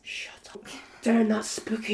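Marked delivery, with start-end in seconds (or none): 0.0-0.6 very soft, unvoiced disbelief; barely audible whispered reaction; spooked whisper. Real, not acted. Hushed reaction to spooky crystal story.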